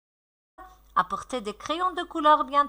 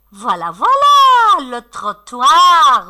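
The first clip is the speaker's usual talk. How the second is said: excited praise; 2 semitones higher, 9 dB louder, slower than usual. Pretend. Teacher welcoming pupils' word, excited. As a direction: call it out triumphantly.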